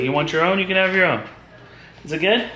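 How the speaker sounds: high, indulgent sing-song; caregiver talk. Real, not acted. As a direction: talk sweetly upward, as to a little one. Coaxing Abigail with her snack.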